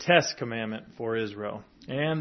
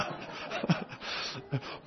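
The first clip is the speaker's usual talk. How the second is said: breathy laugh; 9 dB quieter than usual. Real, not acted. Laughs at own failed cleverness.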